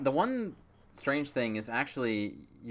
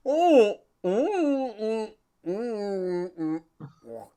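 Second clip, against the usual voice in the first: mouth full; 10 semitones higher, 8 dB louder, much slower than usual. Acted. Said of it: "It just goes".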